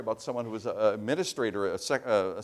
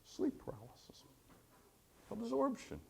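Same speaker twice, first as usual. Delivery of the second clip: mock dismissive subject; 3 semitones lower, 6 dB quieter than usual. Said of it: "She's listening to me like this".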